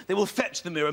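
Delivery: ordinary talk, nothing marked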